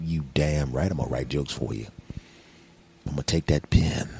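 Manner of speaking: low, soft, suggestive voice; hushed, deep mock come-on; mock-seductive alter ego. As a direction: tease it out low and quiet. Sultry alter-ego talk about writing jokes.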